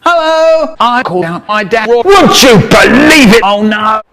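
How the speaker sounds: loud, high call. Acted. Calling out loudly.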